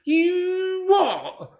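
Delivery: long high-pitched "you what"; mock astonishment. Streamer reacting to thirty-three months of membership, astonished. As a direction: draw it out high in disbelief.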